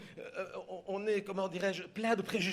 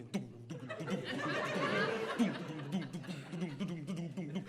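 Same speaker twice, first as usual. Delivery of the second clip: tam-tam imitation; 5 dB quieter than usual. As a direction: beat out tom-tom music with your voice. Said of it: "une musique de tam-tam qui fait". (imitation de tam-tam à la bouche)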